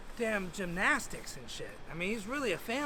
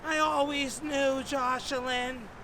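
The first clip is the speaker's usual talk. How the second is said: high, slow motherly lament; knowing mom; 6 semitones higher, slower than usual. Retold or imitated. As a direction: draw out each phrase as his mother. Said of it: "And she's like".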